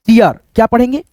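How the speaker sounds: ordinary talk, nothing marked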